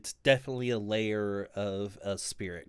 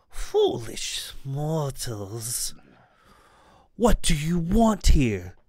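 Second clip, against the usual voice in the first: slow then booming, much louder and higher; booming spirit voice; 4 semitones higher, 8 dB louder than usual. Acted. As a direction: loom over them as a wrathful ghost. GM voices ghost elf.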